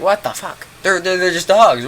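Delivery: high-pitched, stammering retold exasperation; exasperated disbelief. Talking down friends scared of dogs, exasperated disbelief. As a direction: protest incredulously, pitched well up.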